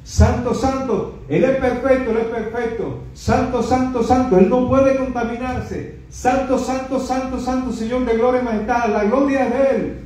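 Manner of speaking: high exalted chanting of holy; high, ringing worship chant; angels proclaiming. Retold or imitated. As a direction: sing out praise like the seraphim. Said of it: "ángeles que decían".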